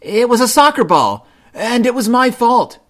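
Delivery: high, loud cub shout; shouted confession. A narrator's reading. Brother Bear confessing to Mama, shouting. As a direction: shout it out to own the blame. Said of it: "shouted brother".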